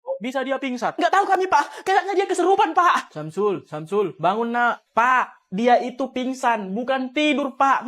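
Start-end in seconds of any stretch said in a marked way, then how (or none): 0.9-3.2 mock worry. Kids feigning worry, high.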